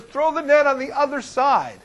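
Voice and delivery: calling from shore. Acted. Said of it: "then he says".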